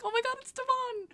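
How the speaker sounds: high-pitched retold fangirl squeal; contained, high mock freak-out; starstruck squeal. Acts out her starstruck reaction.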